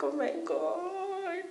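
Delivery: high, slow frightened gasp; a trembling oh my God; scared. Retold or imitated. Girls frightened seeing others being caned. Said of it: "We're like".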